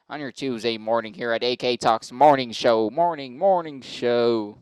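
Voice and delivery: mock morning-show announcer, drawing out the jingle; big radio intro, stretched slow at the end; radio host parody. Host greeting listeners on Tuesday morning, hammed-up radio announcer.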